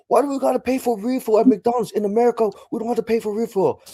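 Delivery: high, loud entitled Karen whine; mock Karen complaining. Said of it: "They're like".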